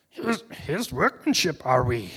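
slow, put-on creature impression; slow mock wise-alien voice; Yoda. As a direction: swoop the pitch, slow and comic. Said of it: "As Yoda would say".

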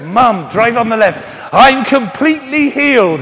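loud, high shouted banter; hammed-up shouting retort; mock mum and son exchange. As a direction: yell it across the car, laughing. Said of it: "I said".

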